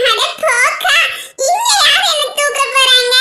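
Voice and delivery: put-on high child voice, dismissive; cheeky little girl. Little girl scoffs at elder's warning, cheeky child voice. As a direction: brush it off like a cheeky small child.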